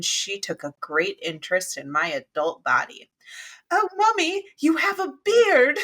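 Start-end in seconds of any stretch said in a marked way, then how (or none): 3.7-5.8 put-on very high, loud little-child voice; three-year-old daughter. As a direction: mimic a small child loudly announcing a discovery. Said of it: "when my daughter was three".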